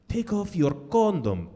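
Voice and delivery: slow, lightly put-on Turkish accent; mock Turkish accent. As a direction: drawl it like a wooden porn actress with a Turkish accent. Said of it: "And she's like".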